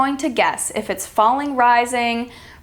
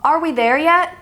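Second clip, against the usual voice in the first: higher-pitched question climbing on "yet"; rising intonation; 6 semitones higher, 3 dB louder than usual. Shown as a demonstration. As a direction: lift "yet" sharply at the end. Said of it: "That's rising".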